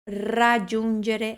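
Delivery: "Raggiungere" slow, with rolled initial r; trilled R. Shown as a demonstration. Teacher explains the trembled R, trilled demo. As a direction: roll into "Raggiungere" on the tongue tip. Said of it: "é um R tremido".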